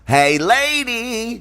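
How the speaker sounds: high, very slow nasal-free hey lady; Jerry Lewis impression.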